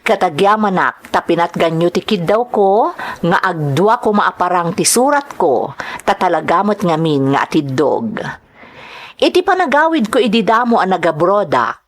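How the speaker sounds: ordinary delivery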